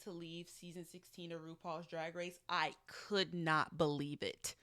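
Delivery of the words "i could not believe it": loud, slow "could not believe it"; emphatic disbelief. Real, not acted. Fan on Mirage leaving second, emphatic disbelief. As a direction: land each word of "could not believe it" separately and loudly.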